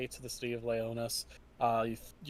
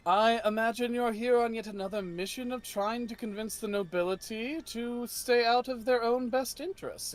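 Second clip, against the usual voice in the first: higher, smug courtly voice; higher noble sneering politely; knight's voice; 8 semitones higher than usual. Acted. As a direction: needle them with polite scorn.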